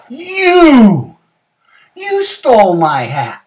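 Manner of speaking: high, drawn-out accusing shout; loud accusation. Acted. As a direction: shout it, stretching the first word. Bear accuses the rabbit.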